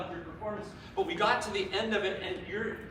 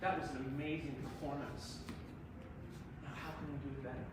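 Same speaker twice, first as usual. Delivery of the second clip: soft quoted aside; 4 semitones lower, 8 dB quieter, faster than usual. Pretend. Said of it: "I like to say".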